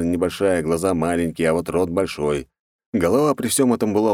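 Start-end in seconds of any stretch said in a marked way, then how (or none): none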